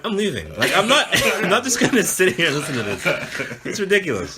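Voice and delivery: high-pitched protest through laughter; laughing indignation. Retold or imitated. Roommate retells fleeing the noise, laughing indignation. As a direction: complain while cracking up.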